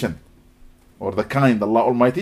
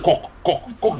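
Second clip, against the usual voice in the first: sudden imitated heel clacks; clicking heel noise; 2 semitones higher, much slower than usual. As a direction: break the silence with mouth-made clicks. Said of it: "it make noise".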